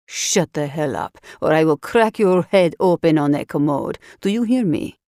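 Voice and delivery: low, menacing Russian thug; Russian accent. Acted. Russian guard threatens the captive in a low Russian accent. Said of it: "He had a thick Russian accent".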